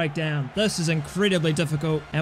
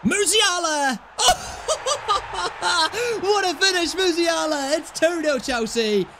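goal yell with laughter; 9 semitones higher than usual. Real, not acted. Second goal scored.

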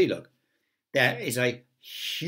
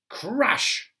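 crash sound effect; 9 semitones higher, 4 dB louder than usual. A narrator's reading. Narrator voices the chair falling.

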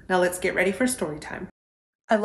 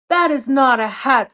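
loud, higher insistence; emphatic fox, louder; firm correction; 5 semitones higher, 8 dB louder than usual. Acted. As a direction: set him straight firmly. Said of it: "said Fox".

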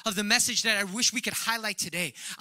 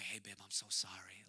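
softer, lower whispered apology to his wife; sheepish whisper; 11 semitones lower, 15 dB quieter than usual. Retold or imitated. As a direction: whisper it like a guilty husband.

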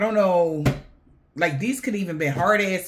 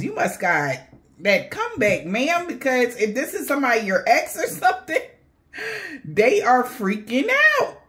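usual speaking voice

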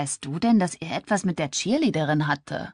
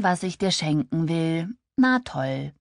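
usual speaking voice

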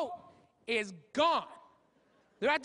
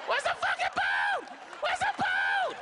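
very high, drawn-out acted scream; panicked yell; slower than usual. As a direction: cry out like a stranded diver. Acts stranded divers in the preview.

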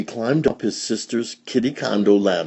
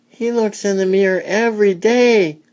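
high, loud little-sister voices; kitten sisters; 13 semitones higher, 5 dB louder than usual. A narrator's reading. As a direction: pipe up high as tattling sisters. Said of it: "said his sisters".

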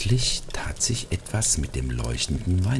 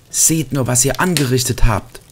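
much higher, louder scolding; reproachful cry; 9 semitones higher, 6 dB louder than usual. A narrator's reading. Marlin scolding the ornaments over the broken angel, crying out. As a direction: cry out at them in dismay. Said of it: "rief sie".

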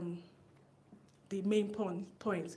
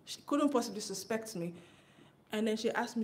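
ordinary talk

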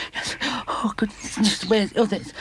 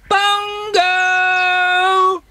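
loud, higher call across the common; 8 semitones higher, 5 dB louder than usual. Acted. Wellington searching for his friend Bungo, calling out loud. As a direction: shout his name to find him.

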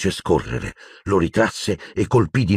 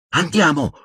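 high urgent call to come; high, pressing call; 12 semitones higher than usual. A narrator's reading. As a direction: call him away, anxious. Hermione calling Harry away.

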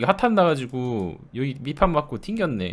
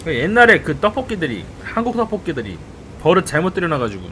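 normal talking voice

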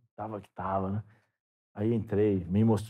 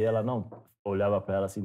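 usual speaking voice

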